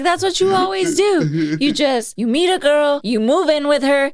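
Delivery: nagging, played lightly for comedy; imitated nagging mom. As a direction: list the faults like a fed-up mother. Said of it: "she's just giving him shit".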